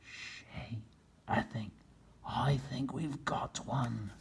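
soft hushed whisper. Acted. Captain Bill whispering to his men behind a tree. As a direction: whisper so the turkey won't hear. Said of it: "he whispered".

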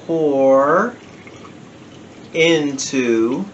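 slow, drawn-out mock showman build-up; lightly hammed-up magician patter. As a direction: pause between words like a magician teasing a trick. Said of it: "I'm saying it like I'm a magician or something".

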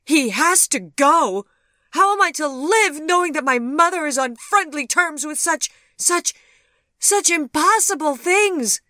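loud, high hysterical demand; distraught cry. A narrator's reading. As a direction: wail the protest, faltering on the outrage. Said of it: "she cried".

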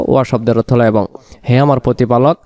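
ordinary talk, nothing marked

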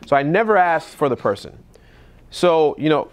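ordinary delivery

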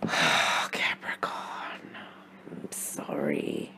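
breathy sigh into a hushed sorry; barely voiced, slow apology to the sign; whispered apology. Real, not acted. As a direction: let it fall to a soft, sorry whisper. Reader sighs, apologises to Capricorn.

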